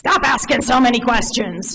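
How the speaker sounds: mock stern dad. Dad scolding his curious daughter, mildly stern. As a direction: lightly scold the curious kid. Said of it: "he was like".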